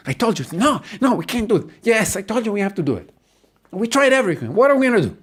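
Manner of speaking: high-pitched, frantic bickering; couple fighting. Acted. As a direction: argue with your spouse in rising desperation. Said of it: "you start fighting".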